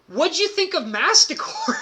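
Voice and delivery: high, laughing tease; can't hold back the laugh; teasing, laughing. Real, not acted. Teasing his beaten friend, laughing through the question.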